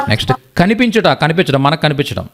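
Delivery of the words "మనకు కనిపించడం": usual speaking voice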